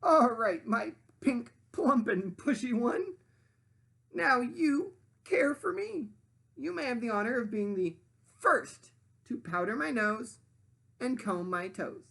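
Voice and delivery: high, loud demanding sandwich voice; high bossy sandwich lady voice. Acted. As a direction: play the pushy sandwich, high and grand. Sandwich ordering Pinkerton.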